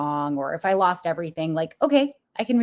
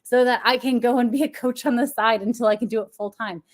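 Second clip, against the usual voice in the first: louder and higher, pleading with frustration; like you want to shake them by the shoulders; a fed-up rant at the interviewers; exasperated outburst; 6 semitones higher, 6 dB louder than usual. Pretend. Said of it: "I wanted to shake them and just be like".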